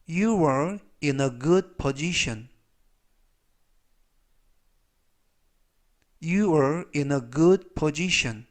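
very slow, measured English read-aloud; slow model reading. Shown as a demonstration. Teacher reads example sentences for learners to review, slowly. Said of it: "천천히 세 번씩 읽어드리고요".

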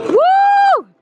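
high loud whoop of excitement; excited whoop. Real, not acted. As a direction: let out a big excited woo. Cheering at the skating rink.

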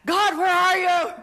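high, loud anguished cry to God; a father's loud, high plea; desperate cry. Retold or imitated. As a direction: cry out to heaven in despair.

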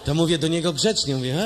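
normal talking voice

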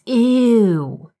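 put-on high, squealing little girl's "Ew! Ew!"; a small child echoing her grandpa in mock disgust; child's squeal. A narrator's reading. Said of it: "Kayla echoes".

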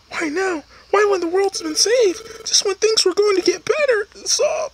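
high, pleading partner shocked at goodbye; high distraught partner voice; partner's high voice. Acted. Voices partner character in game.

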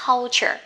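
culture said slowly with a curled l; model culture. Shown as a demonstration. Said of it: "thêm chữ L cong vô".

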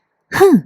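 high, sharp pouting hmph; sulky hmph. A narrator's reading. Sulking wife huffs at her husband, high hmph. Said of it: "จึงตีหน้าบึ้ง".

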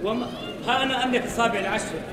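ordinary delivery

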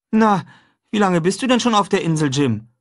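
put-on high, lively boyish voice; a cheerful boy proposing breakfast; boy talking brightly to his friend; young boy Huck. A narrator's reading. Huck's voice.

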